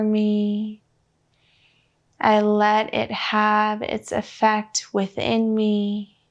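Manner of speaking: slow, even meditation voice with long pauses; unhurried guidance, leaving room for breath between phrases; guided meditation. Real, not acted. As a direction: lead them gently inward, phrase by measured phrase.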